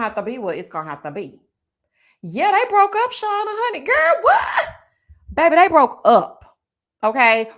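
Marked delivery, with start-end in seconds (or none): 2.2-4.7 high loud shrieking gossip; shocked gossip. Acted. Telling viewer Shauna about the breakup, high shriek. 5.3-7.5 louder, punching each word; loud emphatic news. Real, not acted. Insisting to viewers the couple broke up, loud.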